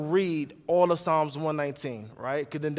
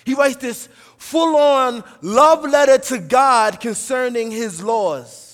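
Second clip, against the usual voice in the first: loud, raised, driving sermon delivery; full-force preaching; 5 semitones higher, 9 dB louder than usual. Acted. Preacher telling the church how the psalmist loves God's law, full-force preaching. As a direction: preach it forcefully, punching the key words.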